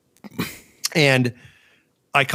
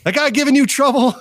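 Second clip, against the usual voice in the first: put-on high, raised voice of the old machinist; old Italian neighbor; 12 semitones higher, 3 dB louder than usual. Old machinist neighbor asking about trouble, high lightly voiced. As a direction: play the friendly old neighbor. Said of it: "he said".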